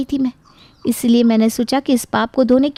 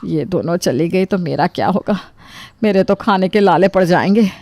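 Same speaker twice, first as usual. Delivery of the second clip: panicked thought; 3 semitones lower than usual. Acted. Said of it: "ये सुनते ही रूपा के तो होश उड़ जाते हैं".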